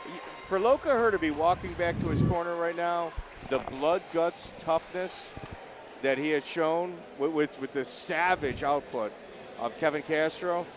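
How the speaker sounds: rising, building excitement over the result; excited commentary. Real, not acted. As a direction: call the finish with growing awe. Commentator excited at fight end.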